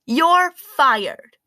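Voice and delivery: loud, high "you're fired"; a child's bossy declaration; mock firing. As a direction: announce it like a boss. Child firing her dad.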